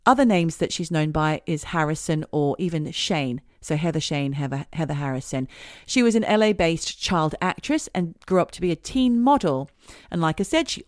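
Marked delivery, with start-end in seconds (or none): none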